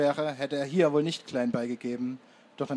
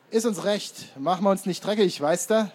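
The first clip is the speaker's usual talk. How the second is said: put-on loud, raised, brash street-kid retort; cocky taunt; 6 semitones higher, 7 dB louder than usual. A narrator's reading. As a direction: throw it back loudly and cheekily. Frecher Anführer verhöhnt die Hitlerjungen. (laut und frech zurückgegeben)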